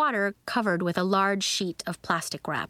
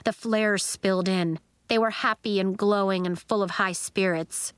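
usual speaking voice

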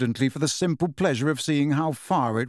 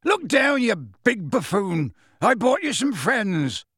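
high calling then slow firm chiding; Hagrid scolding; 8 semitones higher, slower than usual. A narrator's reading.

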